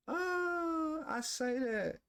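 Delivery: high mock-wail confession. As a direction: wail it like a guilty confession, hammed-up. Mock confessing negative habits.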